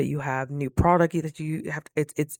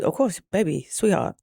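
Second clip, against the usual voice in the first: ordinary delivery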